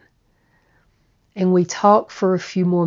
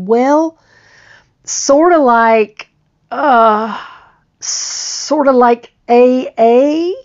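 high, slow, sheepish question; shy louder tentative asking; hesitant confession; 7 semitones higher, 7 dB louder, slower than usual. Retold or imitated. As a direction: ease into the admission, unsure.

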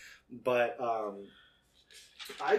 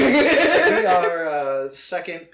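laughing; 5 semitones higher, 13 dB louder, slower than usual. Real, not acted. Laughing at the joke.

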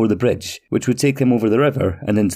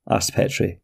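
plain reading voice

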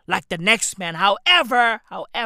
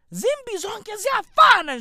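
zimbi zonke ziyafana said much higher; emphatic repeat; 11 semitones higher than usual. Acted. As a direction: lift ziyafana up high with emphasis. Repeats Zulu saying.

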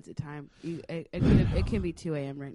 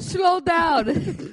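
loud, high mock Jamaican shout; Jamaican accent; 10 semitones higher, 9 dB louder than usual. Jamaican parody voice.

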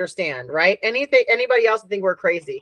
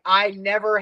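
usual speaking voice

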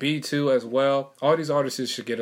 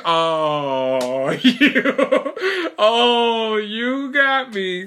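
laughing, high and loud; 10 semitones higher, 7 dB louder, much slower than usual. Real, not acted. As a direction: laugh it out, caught off guard. Fooled by fake sneeze.

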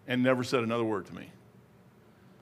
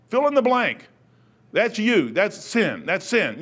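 normal talking voice

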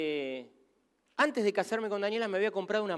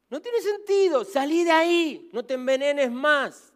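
higher and louder, slow, pressing the listener; urgent plea; 7 semitones higher, 5 dB louder, slower than usual. Real, not acted. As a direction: call them out of it with force. Preacher repeating the urgent plea.

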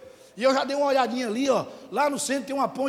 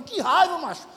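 incredulous protest; 3 semitones higher, 5 dB louder than usual. Retold or imitated. Retold protest at being thought angry.

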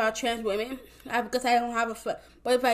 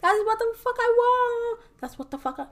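defiant retort; 5 semitones higher than usual. Retold or imitated. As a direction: tell him off, climbing higher each time. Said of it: "I'm like".

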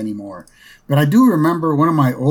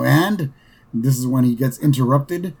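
usual speaking voice

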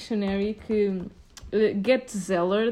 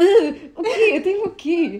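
mock confusion; 6 semitones higher, 5 dB louder, much slower than usual. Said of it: "eu vou ficar tipo".

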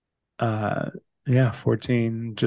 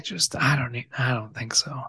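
whisper; 5 dB quieter than usual. Real, not acted. Quiet aside.

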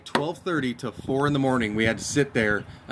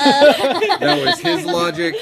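1 laughing; 14 semitones higher, 6 dB louder, much slower than usual. Real, not acted. Laughing at friend's dumb logic.

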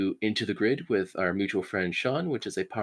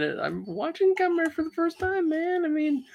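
squealing with excitement; excited high voice. Real, not acted. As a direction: gush about it, pitch way up. Excited about Kamen Rider.

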